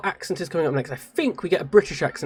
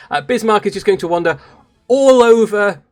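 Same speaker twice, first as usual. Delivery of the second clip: ordinary delivery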